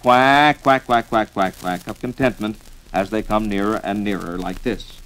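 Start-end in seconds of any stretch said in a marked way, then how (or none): 0.0-1.9 rapid contented quacking call; duck call honks. Shown as a demonstration. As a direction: cram in happy duck quacks. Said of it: "cramming in an optimistic quack".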